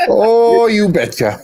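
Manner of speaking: a chirpy Yooper you betcha; playful Yooper from the upper Midwest; Yooper accent. Pretend. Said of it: "Yooper accent".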